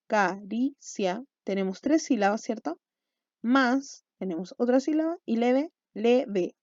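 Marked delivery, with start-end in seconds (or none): 0.1-1.2 "Caricia" slowly as ca-ri-cia, a pause after each syllable; teacher dividing "Caricia" syllable by syllable; split into syllables. Shown as a demonstration. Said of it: "dividámoslo". 5.9-6.5 "leve" slowly in two beats, le, ve; teacher dividing "leve" syllable by syllable; split into syllables. Shown as a demonstration. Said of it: "dos sílabas".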